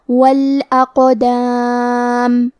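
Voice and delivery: وَالْأَقْدَامِ slowly, heavy qaf with a bounce; qalqalah on qaf. Shown as a demonstration. Teacher demonstrating qalqalah on qaf, slow. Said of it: "ہم یہ حرف کو ہلاتے ہوئے یہ کلمہ کو پڑھیں گے".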